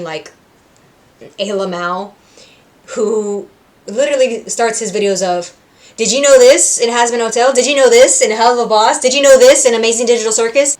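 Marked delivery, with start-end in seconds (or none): 6.0-10.8 a mildly hyped-up, louder video intro; YouTuber tagline. Retold or imitated. A light imitation of a YouTuber opening his videos, louder. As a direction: pitch it like an eager video host. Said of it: "that's his tagline".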